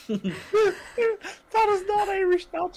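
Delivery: slow, high, laughing through the words; can barely say it for laughing. Real, not acted. Laughing at the idea of stew nachos, slow and high.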